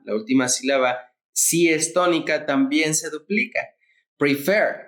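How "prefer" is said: "Prefer" with the stress on FER; stress on last syllable. Shown as a demonstration. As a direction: land the accent on the final syllable of "Prefer". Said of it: "el acento recae en la última".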